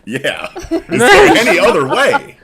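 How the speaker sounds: high, loud laughing question. Real, not acted.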